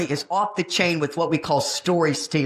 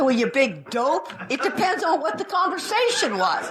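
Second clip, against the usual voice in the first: laughing put-down of the student, pitched up; mock scolding; 7 semitones higher than usual. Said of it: "I said".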